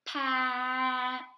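teacher modelling sounds slowly; stretched sounds. Shown as a demonstration. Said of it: "say a word very slowly so you can hear each sound".

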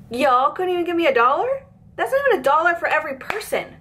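high, outraged disbelief; exasperated rising outrage; indignant rant. Real, not acted. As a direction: rant at the stingy party, voice climbing. Ranting about tiny tip.

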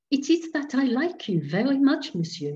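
a hint of a guileless old priest; naive little curé. A narrator's reading. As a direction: priest confiding fondness for his guest, lightly voiced. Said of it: "he said naively".

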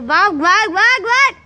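loud, rising calls to run; urging on. Real, not acted. Sister called him, urging him off. (ज़ोर से भगाते हुए)

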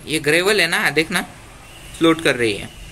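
ordinary talk, nothing marked

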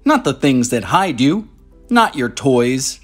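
usual speaking voice

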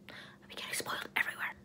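hushed whisper. Real, not acted. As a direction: say it under your breath. Whispered aside about spoilers.

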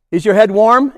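ordinary talk

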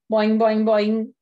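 bouncy shouted boing; spring up on each boing; loud boing sound effect. A narrator's reading. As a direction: pogo stick sound, loud. Pogo stick sound.

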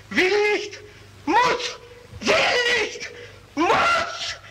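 tearing himself apart between two words; anguished outcry. Acted. Murderer before the mob, crying anguished outburst. Murderer torn by inner compulsion.

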